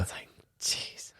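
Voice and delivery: a slow whispered aside. Real, not acted. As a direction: breathe it out as a whisper. Muttered aside about the ice.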